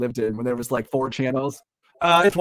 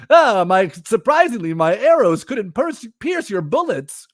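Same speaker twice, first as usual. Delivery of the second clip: hammy hero voice; 8 semitones higher, 6 dB louder than usual. Acted. Acting Green Arrow losing fight.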